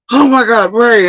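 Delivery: high, panicked mock callers; frantic callers. Friends blowing up his phone, high panicked imitation. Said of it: "phone having been blown up".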